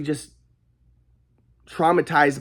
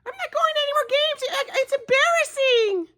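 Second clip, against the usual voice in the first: mocking mom. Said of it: "My mom's like".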